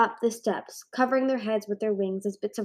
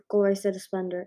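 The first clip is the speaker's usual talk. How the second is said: plain reading voice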